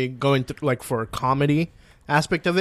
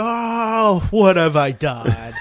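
drawn-out mock horror; slow dramatic lament; 6 semitones higher, much slower than usual. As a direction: wail it slowly like a movie villain. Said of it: "I'm like".

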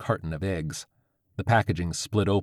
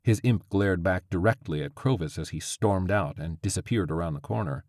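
plain reading voice

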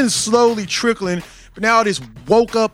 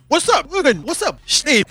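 high street greeting; 8 semitones higher than usual. Retold or imitated.

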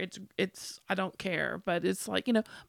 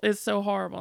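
ordinary talk, nothing marked

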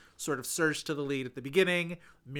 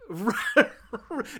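a loud rising burst of laughter; 8 semitones higher, 8 dB louder than usual. Real, not acted. Reacting to a pun.

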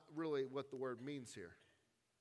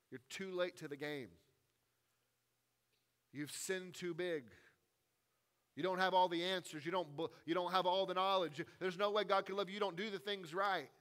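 voice of the accuser; 6 semitones higher, 8 dB louder than usual. Retold or imitated. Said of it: "the whispers of the evil one".

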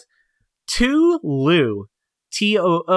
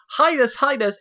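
squeaky little creature voice; 9 semitones higher than usual. Acted. Music note characters speak.

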